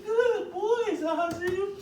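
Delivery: very high, loud "break" and praise; excited high dog-training voice; high-pitched release. Shown as a demonstration. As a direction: squeal the release word up high. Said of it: "it's gotta be high-pitched".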